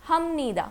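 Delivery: "हम नीदा" with m before the n; teacher's repeat of "हम नीदा", nasal at the join; p changed to m. Shown as a demonstration. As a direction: say "हम नीदा", closing the first syllable on m. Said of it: "फियप का sound किस में change होगा".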